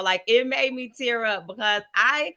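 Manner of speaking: ordinary delivery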